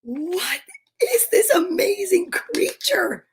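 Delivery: high, slow, awed and tearful; amazed gasp. Real, not acted. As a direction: marvel at the gift, near tears. Unboxing a gift, moved.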